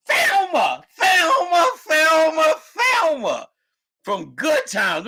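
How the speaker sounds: high, loud fan shout repeating her name; fan screaming. Retold or imitated. Retold fan screaming at celebrity.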